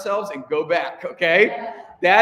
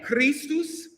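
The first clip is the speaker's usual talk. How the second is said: ordinary delivery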